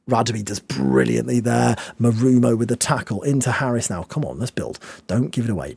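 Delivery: ordinary talk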